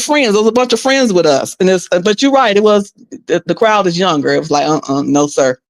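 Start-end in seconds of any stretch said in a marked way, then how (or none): none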